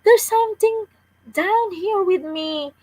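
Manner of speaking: put-on girl scared of something in the dark cave; shaky, scared. A narrator's reading. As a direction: blurt it out in fear. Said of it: "in a shaky voice".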